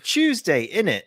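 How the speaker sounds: high, hammed-up British accent; mock British accent. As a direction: put on a silly British voice. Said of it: "Any, any British listeners we just had".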